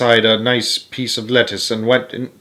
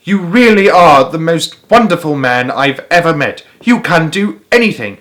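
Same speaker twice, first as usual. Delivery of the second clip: higher and louder, a touch overjoyed; crying out with joy; 6 semitones higher, 4 dB louder than usual. A narrator's reading. Lady welcoming her neighbour at the door, gushing. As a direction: gush at him happily. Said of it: "cried out".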